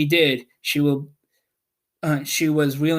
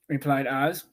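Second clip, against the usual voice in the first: ordinary delivery, nothing marked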